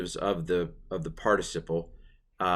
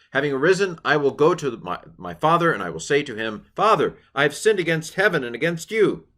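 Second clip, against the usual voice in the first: reading the whole verse higher and louder, with flourish; triumphant recitation; 7 semitones higher, 5 dB louder than usual. Acted. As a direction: declaim the son's return in full grandeur. Climactic full reading of verse.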